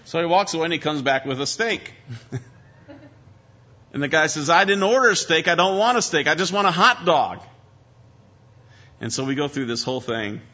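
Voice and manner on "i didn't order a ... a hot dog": louder, higher acted protest; hammed-up annoyed customer; exasperated diner. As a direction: complain indignantly, like a fed-up customer. Skit diner refuses wrong dish.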